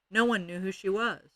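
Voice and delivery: plain reading voice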